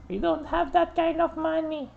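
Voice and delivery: high, embarrassed character voice. Pretend. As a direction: voice them high and sheepish. Voicing embarrassed game characters.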